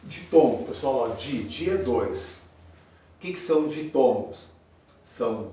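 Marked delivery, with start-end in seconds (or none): none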